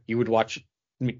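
normal talking voice

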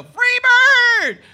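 high, loud bellow of a heckler; drunk heckler yell. Retold or imitated. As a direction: shout it from the back of the crowd. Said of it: "the whole show".

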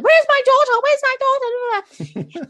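imitating a mother screaming for her child; frantic mother. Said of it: "accusing her of".

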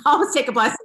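high, bubbling with laughter; laughing aside. Real, not acted. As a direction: chuckle it out as a joke. Repeats the line laughing.

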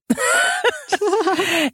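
a high breathy burst of laughter. Real, not acted. Podcaster cracks up at Isabeau's reply.

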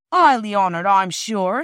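loud, mock-honored huffiness; cold, offended porter. A narrator's reading. Porter coldly receiving the children, loud offended sarcasm. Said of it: "were very coldly received by Perks".

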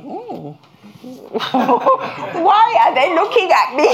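high, puzzled, laughing through it. Retold or imitated. Preacher laughing at strangers staring at him. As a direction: laugh at your own confusion. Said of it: "and I was like".

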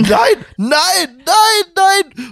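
high, loud squealing refusals; emphatic no. Real, not acted. Answering cohost about fatherhood, high loud squealed no. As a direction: shriek no over and over.

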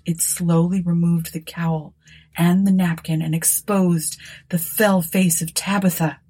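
usual speaking voice

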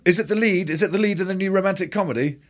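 high, eager hopeful actor. Retold or imitated. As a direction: ask it high and eager, hoping for the lead. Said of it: "the agent will phone".

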